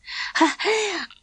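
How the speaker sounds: short high nervous giggle. A narrator's reading. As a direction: giggle awkwardly, unsure of herself. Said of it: "Soppy Elaine giggled nervously".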